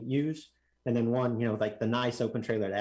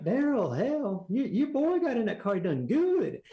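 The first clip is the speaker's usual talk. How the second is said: put-on old track owner; 11 semitones higher, slower than usual. Old owner praising to dad.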